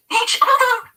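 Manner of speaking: high, loud exasperated outburst; shrill corrected answer; exasperated cry. A narrator's reading. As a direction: cry it out at her wits' end. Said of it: "Trish cries in exasperation".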